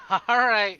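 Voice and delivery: loud laugh into a bright all right. Real, not acted. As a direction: laugh it off loudly. Laughing reply to friend.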